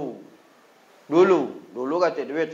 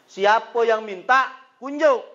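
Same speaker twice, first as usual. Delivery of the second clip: higher and louder, slow; preacher drives the Palembang line home to his congregation, higher and louder; punchy exclaimed punchline; 6 semitones higher, 8 dB louder, slower than usual. Acted. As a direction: hit it like a punchline in the sermon.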